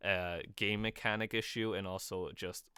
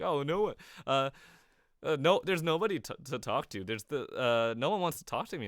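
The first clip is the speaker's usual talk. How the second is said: higher-pitched, louder mock whine; mock complaining; 6 semitones higher, 5 dB louder than usual. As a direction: imitate people complaining they're left out. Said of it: "all these people complaining".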